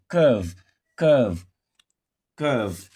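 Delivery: "curve" with a long uh sound for the UR; long ER vowel. Shown as a demonstration. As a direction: say "curve" with a drawn central vowel, not AW. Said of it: "We pronounce the U R as, uh, long, uh".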